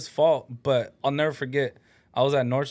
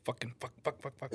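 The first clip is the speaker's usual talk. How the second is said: low-volume muttered string of curses; soft, rapid-fire swearing imitation; imitating dad's swearing; 3 semitones lower, 16 dB quieter than usual. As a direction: mutter it repeatedly, imitating a habit. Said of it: "My dad says fucking with everything".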